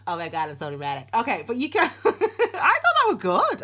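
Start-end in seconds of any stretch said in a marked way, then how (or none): 0.1-1.1 rapid put-on American accent; playful, hurried American accent. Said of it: "That was an American accent". 2.1-3.6 high, loud laughing protest; laughing too hard, words stretched out; helpless laughter. Real, not acted. Laughing at own failed accent.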